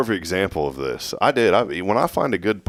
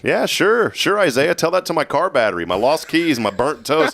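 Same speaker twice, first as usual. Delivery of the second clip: higher-pitched mock agreement, exaggerated; sarcastic; 8 semitones higher than usual. Host roasting his co-host's favourite saying, sarcastic.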